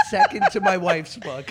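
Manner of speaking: laughing out the punchline; loud laugh through the words. Retold or imitated. Quoting the husband, laughing.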